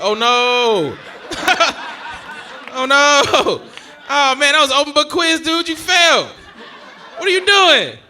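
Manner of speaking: loud, high, hammed-up dismay; mock dismay. Watching a man fail an easy quiz, mock dismay. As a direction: wail as if he blew an easy test. Acted dismay at a wrong answer.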